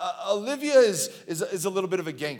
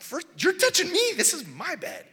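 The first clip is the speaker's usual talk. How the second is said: mock indignant; 9 semitones higher than usual. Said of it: "I'm like".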